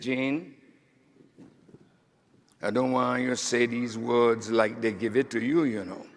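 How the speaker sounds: a gentle, low hint of a West Indian mother's lilt; imitating mother's West Indian accent. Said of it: "with her beautiful West Indian accent".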